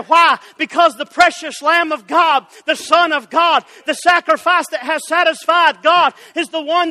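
loud, driving revival sermon; fervent preaching. Real, not acted. Preacher to congregation on the empty tomb, fervent preaching. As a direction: preach it with rising conviction.

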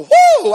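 sudden high, loud mock whoop of triumph. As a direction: hoot like a show-off in heaven. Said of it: "parade around heaven going".

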